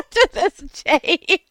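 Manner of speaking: high, loud words squeezed out between laughs; real, loud laughing fit breaking up the talk; helpless laughter. Real, not acted. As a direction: crack up all through the line. Laughing at remembered mishap.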